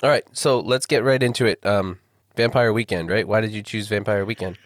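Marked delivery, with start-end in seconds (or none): none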